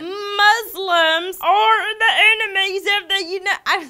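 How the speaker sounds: put-on an unwanted Southern accent he can't shake. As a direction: read the line like a Southern good old boy. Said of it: "That's still Southern".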